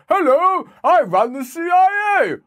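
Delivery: very high, slow, singsong hello; silly sitcom character. Acted. CIA boss in a spoof sitcom introducing himself, silly high voice. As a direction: ham up a moustached sitcom official. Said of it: "a sitcom where they put moustaches on".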